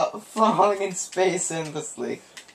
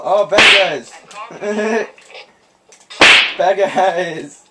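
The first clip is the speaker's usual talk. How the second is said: excited shout; 7 dB louder, slower than usual. Real, not acted. Spotting enemies, calling out.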